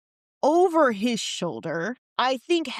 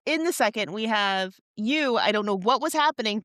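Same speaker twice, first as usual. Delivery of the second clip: ordinary delivery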